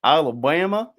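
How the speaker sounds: put-on loud, clumsy Southern US accent. Australian having another go at Alabama talk, loud Southern accent. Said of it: "I'm probably making it sound bad".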